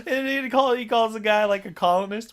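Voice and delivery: talking through laughter; laughing. Real, not acted. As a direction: laugh while you speak. Laughing while describing movie.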